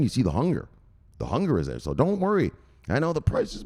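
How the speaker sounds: normal talking voice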